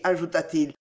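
ordinary delivery

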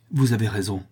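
usual speaking voice

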